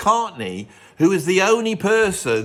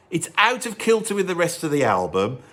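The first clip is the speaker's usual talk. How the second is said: ordinary talk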